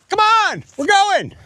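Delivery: high, ringing call uphill. Real, not acted. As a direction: call them to hurry along. Calling companion up hill.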